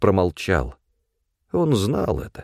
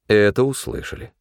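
ordinary delivery

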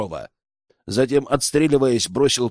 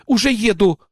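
raised, higher-pitched retort; grumbled reply; 6 semitones higher than usual. A narrator's reading. Girl says she is driving, higher grumbled retort. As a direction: answer back high, mildly impatient. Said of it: "буркнула девушка".

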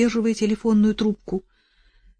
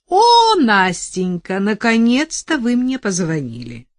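gushing lawyer; 4 semitones higher, 6 dB louder than usual. Acted. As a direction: greet her with fake delight. Lawyer greets her on phone.

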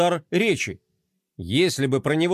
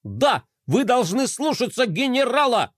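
a hint of an eager robot; lightly voiced little robot; 7 semitones higher than usual. Little robot siding with the general, eager. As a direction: chime in eagerly.

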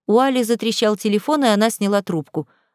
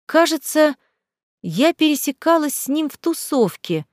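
higher, hesitant drawl; drawn-out musing; 7 semitones higher than usual. A narrator's reading. Аля recalls meeting him at a party, drawling. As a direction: stretch it out while trying to remember. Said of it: "протянула Аля".

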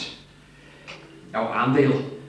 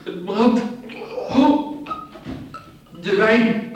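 pained cries; 9 semitones higher, much slower than usual. Acted. Poisoned man crying out in agony as the wine burns.

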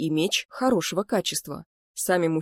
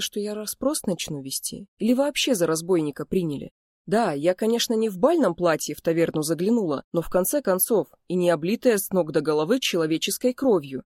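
ordinary delivery, nothing marked

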